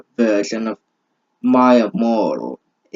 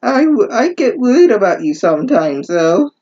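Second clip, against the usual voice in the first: high, hammed-up lovesick boyfriend; mock Denmark voice; 9 semitones higher, 3 dB louder than usual. Reader voicing Denmark's reply to Reichu, high mock lovesick voice. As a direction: squeak it out like a cheesy romance hero. Reading Denmark's line aloud.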